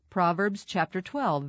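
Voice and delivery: ordinary delivery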